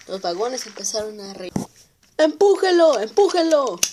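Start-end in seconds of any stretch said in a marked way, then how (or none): 2.2-3.8 high, loud taunting shout. Acted. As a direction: jeer and push the engine on.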